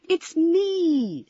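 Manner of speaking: put-on a young girl calling out to calm him; girl's voice. Ramona startles Sandy in the fog.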